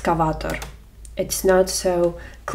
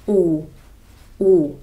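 ordinary talk

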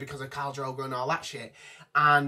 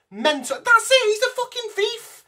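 much higher and louder, shouting in disbelief; exasperated outburst; 9 dB louder than usual. Real, not acted. Vlogger ranting at viewers about the new treasurer, shouting in disbelief. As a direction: blow up at the absurd choice. Rant peaks about untrustworthy Bronn.

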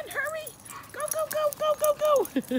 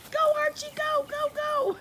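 cheering on. Real, not acted. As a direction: cheer him on, squealing. Cheering dog on.

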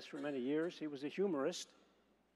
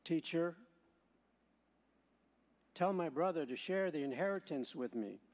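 Gospel reading. Acted. Proclaims the Gospel at Mass.

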